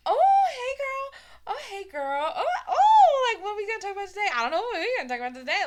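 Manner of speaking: put-on high, excited girly greeting, easing down; chatting with herself. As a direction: act out a gushing chat with yourself. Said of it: "full-on conversation with my fucking self".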